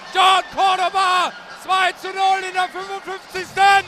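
yelling the goal call; roaring Tor over the crowd; screaming goal commentary. Real, not acted. Radio goal call, second goal.

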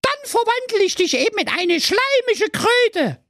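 very high, scolding fairy voice; evil fairy threatening. Acted. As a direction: threaten a spell in a high witchy pitch. (sehr hohe, drohende Feenstimme)

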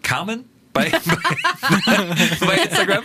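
laughing through the words, pitch jumping up. Real, not acted. Host laughing while plugging Instagram to listeners. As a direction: giggle your way through the plug.

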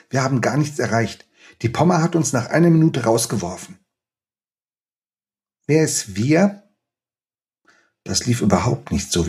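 measured, expressive reading aloud of a scene; story reading. Acted. Author reading his novel to the interviewer, expressive storytelling. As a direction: read the chapter to the audience with feeling.

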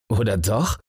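a touch of laughter on the words; tossed off with a light, mocking laugh. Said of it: "lacht Holzer". (Holzer fragt Bang spöttisch, leicht lachend)

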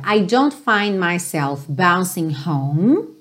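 linked phrase very slowly; slow demo. Shown as a demonstration. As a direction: take it slowly for learners. (bem devagarzinho)

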